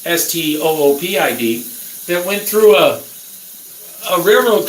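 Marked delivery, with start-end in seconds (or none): 0.1-1.7 spelling out S T O O P I D letter by letter; spelled out. Shown as a demonstration. Ranting at careless drivers, spelling each letter. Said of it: "stupid people".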